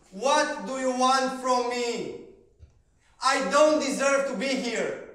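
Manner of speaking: loud, high, slow cry of pain; desperate outburst. Retold or imitated. Yelling at his coach, anguished and furious. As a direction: tear it out of you, word by word.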